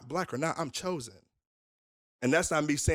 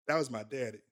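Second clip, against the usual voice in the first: ordinary talk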